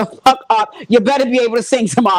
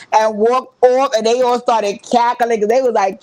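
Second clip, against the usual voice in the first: ordinary delivery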